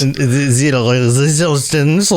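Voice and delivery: put-on slow, hammy French accent that falls apart; bad French accent. Host admits his French accent is getting worse, slow and hammy. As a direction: drag out the Resistance in an overdone French accent. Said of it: "It's getting worse as I do it".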